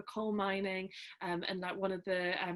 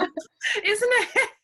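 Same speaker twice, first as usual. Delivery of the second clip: high, loud laughing question; real shared laughter; 14 semitones higher, 9 dB louder than usual. Real, not acted. Laughing together at coincidence.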